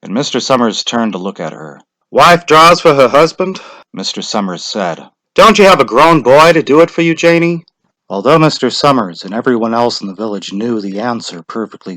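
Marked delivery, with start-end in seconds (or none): 2.1-7.6 louder, higher formal question; official. A narrator's reading. Official questions the wife, louder and formal. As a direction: ask it by the book.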